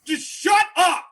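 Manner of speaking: drawn-out shout telling the priest to shut up; mock shout. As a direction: yell it slowly, staring him down. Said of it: "I'm like".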